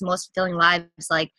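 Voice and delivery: usual speaking voice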